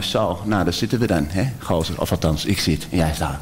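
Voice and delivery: lightly put-on Amsterdam accent, low and rough; a hint of a low Amsterdam gozer voice. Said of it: "Hij praatte anders".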